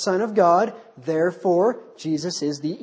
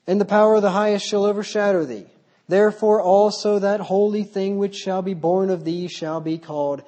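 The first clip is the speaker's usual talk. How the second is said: raised, solemn reading of the verse; scripture reading; 3 semitones higher, 4 dB louder than usual. Acted. Preacher reading the angel's words aloud, raised and solemn. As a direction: proclaim the verse to the room.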